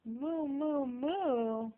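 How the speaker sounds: put-on high sing-song moo for a toddler; cow moo. Shown as a demonstration. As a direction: moo like a cow to teach a baby. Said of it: "What does the cow say?".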